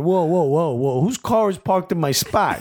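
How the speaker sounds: high, indignant mock Jersey accent; Bob Bradley. Coach walking in late to a conference, indignant Jersey accent. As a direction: protest like an affronted Jersey guy. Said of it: "knocked the Jersey accent out".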